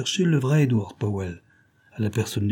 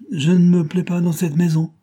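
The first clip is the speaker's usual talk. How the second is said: testy; 7 semitones higher, 5 dB louder than usual. A narrator's reading. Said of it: "dit-il avec humeur".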